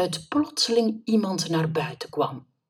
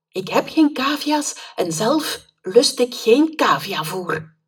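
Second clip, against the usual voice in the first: put-on a higher, louder nervous boy's voice; flustered boy; 6 semitones higher, 6 dB louder than usual. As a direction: blurt it out and get away. Boy flustered, bolts to exit.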